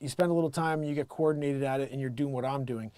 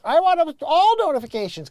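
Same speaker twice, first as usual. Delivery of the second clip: mock demanding shout; 12 semitones higher, 9 dB louder than usual. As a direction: belt it out like an over-eager subscriber. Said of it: "You have to be like".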